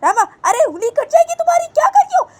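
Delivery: very high, loud alarmed squeal; high panicked cartoon voice; shrill panicked voice. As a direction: squeak out a frantic warning. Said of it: "तो बानी बोलती है".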